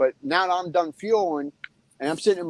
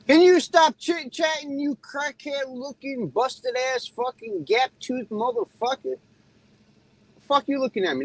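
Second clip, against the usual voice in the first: slow, drawn-out taunting insults, pitched higher; 7 semitones higher, slower than usual. Real, not acted. Trucker taunting a distracted driver, slow high sneer. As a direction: sneer each insult out slowly. Yelling at driver through windshield.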